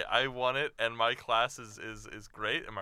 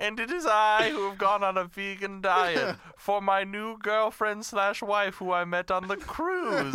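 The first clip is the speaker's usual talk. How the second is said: Dracula voice; 8 semitones higher, 3 dB louder than usual. Pretend. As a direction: ham up a count with a laugh.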